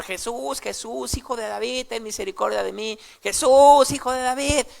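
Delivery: high pleading cry to Jesus; calling out. Retold or imitated. Blind man crying out to Jesus for mercy, high pleading shout. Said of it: "empieza a gritar".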